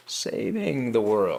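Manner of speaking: scare quotes. Acted. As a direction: stretch it out in audible air quotes. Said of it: "what's up with those scare quotes".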